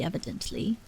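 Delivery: ordinary delivery, nothing marked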